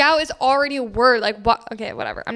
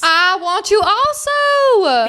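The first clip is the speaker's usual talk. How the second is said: high, long drawn-out longing; eager plea; 7 semitones higher, 3 dB louder, much slower than usual. Real, not acted. Friend begging to see the movie too, high drawn-out eagerness. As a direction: stretch it out, voice swooping up.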